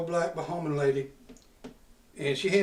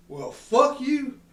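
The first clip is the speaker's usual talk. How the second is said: slow, higher, louder drawn-out insult; mock angry retort; 7 semitones higher, 4 dB louder, much slower than usual. Retelling cursing the customs clerk, slow and heated. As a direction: spit it out slowly at her.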